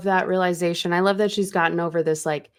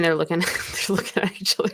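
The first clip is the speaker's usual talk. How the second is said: fast, laughing through the words; cracking up; much faster than usual. Real, not acted. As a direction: break into giggles mid-sentence. Cracks up while retelling.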